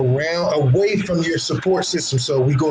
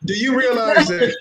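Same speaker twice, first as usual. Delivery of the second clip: normal talking voice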